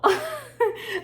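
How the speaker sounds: a high, loud laugh breaking through; loud laughing shriek. Real, not acted. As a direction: cackle with real amusement. Laughing at "Girl.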